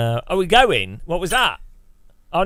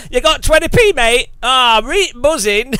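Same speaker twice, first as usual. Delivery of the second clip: loud, high, hammed-up Midlands geezer; mock Midlands accent; 8 semitones higher, 7 dB louder than usual. Streamer parodies engineer Mark with a loud, high Midlands accent. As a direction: overplay a Midlands lad bursting with excitement. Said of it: "He's got the middle accent".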